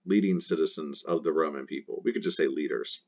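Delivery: ordinary talk, nothing marked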